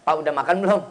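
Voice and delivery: mildly curt, low-pitched question; curt wife. Acted. Wife asking husband about his meal, mildly curt. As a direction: fire off a bare question, no tenderness. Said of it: "Kalau nada seorang istrinya judes".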